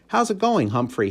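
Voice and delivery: cheerful janitor. A narrator's reading. Aldo's voice.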